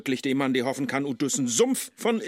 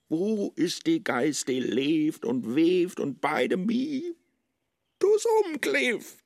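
anguished rising lament; 7 semitones higher than usual. Acted. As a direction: voice climbing higher and higher, pleading. Faust's anguished monologue, pitch soaring.

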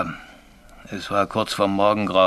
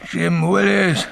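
drawn-out, higher call, rising in intensity; calling ghost voice; 7 semitones higher, much slower than usual. Acted. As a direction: imitate a phantom calling someone's name. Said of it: "hörte ich auch die rufende Stimme". (langgezogene, rufende Geisterstimme)

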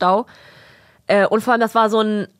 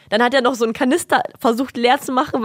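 ordinary talk

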